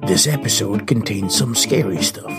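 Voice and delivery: ordinary delivery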